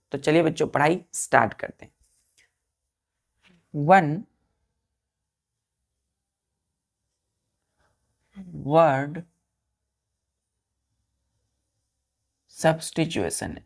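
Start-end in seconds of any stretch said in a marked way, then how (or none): 3.7-13.6 slow, drawn-out dictation for writing. Shown as a demonstration. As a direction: dictate each word slowly for the class. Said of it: "teacher dictating slowly". (धीमे-धीमे बोलकर लिखवाना)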